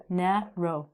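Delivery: "Narrow" split into two separate beats, na and row; syllable by syllable. Shown as a demonstration. Teacher counting two syllables for students, "Narrow" as na, row. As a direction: break "Narrow" after the first vowel sound. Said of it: "we can actually count these as two".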